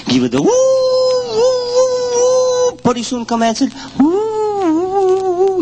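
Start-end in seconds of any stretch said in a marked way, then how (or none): 0.4-2.8 hooting call. Acted. Guide hoots into the cave to call the bear. 4.0-5.6 high woo-woo answer from far inside; answering hoot. Acted. Woman answers the call from inside the cave, hooting high.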